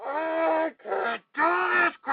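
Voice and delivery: slow, tearful plea; crying out, near collapse; breaking down crying. Acted. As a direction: let the voice crumble into sobs. Host breaking down on air.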